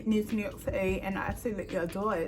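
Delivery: put-on London accent; fake British accent. Telling a stranger she's from London in fake British accent. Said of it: "my accent".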